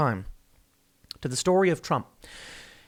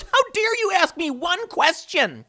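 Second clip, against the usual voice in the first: high, drawn-out mock indignation; whiny mock outrage, stretched out high; Joe Biden; 12 semitones higher, slower than usual. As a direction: wail it like a pathetic, outraged loser. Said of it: "like some pathetic loser hiding in his basement, goes".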